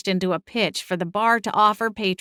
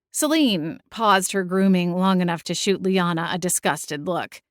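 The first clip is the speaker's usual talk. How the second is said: plain reading voice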